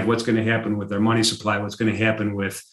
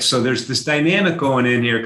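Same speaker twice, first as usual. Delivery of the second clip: ordinary talk, nothing marked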